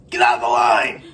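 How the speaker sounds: loud, low grumpy shout; gruff shout. Acted. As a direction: bark it like a grumpy old man. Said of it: "You should hear him".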